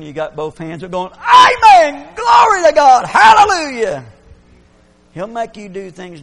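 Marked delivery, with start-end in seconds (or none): 1.1-4.0 far louder, higher ecstatic praise shout; joyful shout. Retold or imitated. Preacher acting out a worshipper who gets moving, ecstatic shouting. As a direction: shout it out like a man filled with the Spirit. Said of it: "when God gets him moving".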